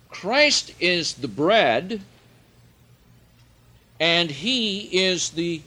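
loud, high, slow proclamation; full-force preaching. Acted. Preacher to congregation, loud slow high preaching. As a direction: hammer each phrase home.

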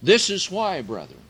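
loud, high, slow preaching; full-force preaching. Acted. Preacher to the brethren, loud high preaching. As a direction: drive the words home to the brethren.

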